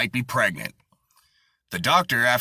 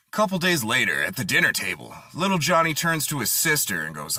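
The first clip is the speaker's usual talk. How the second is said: normal talking voice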